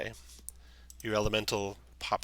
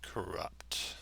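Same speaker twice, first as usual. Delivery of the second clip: slow treant voice; 6 dB quieter, much slower than usual. Acted. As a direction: let the tree speak slowly. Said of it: "slow voice".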